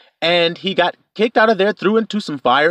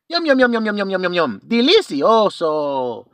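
mock savoring; 4 semitones higher than usual. Eating the turkey.

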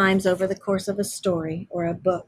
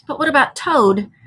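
raised-pitch worried question; mildly anxious; 7 semitones higher, 4 dB louder than usual. A narrator's reading. Mole asking Rat about abandoned Toad, mildly anxious. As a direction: ask with a touch of worry, pitched up. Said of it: "asked the mole anxiously".

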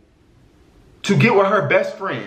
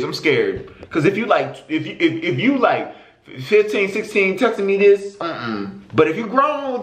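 ordinary delivery

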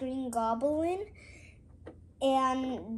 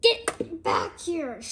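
higher, drawn-out villain order; play villain command; 6 semitones higher, slower than usual. Pretend. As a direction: order the hero back in a put-on voice. Green Goblin chases in play.

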